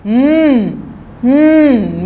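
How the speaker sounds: ordinary delivery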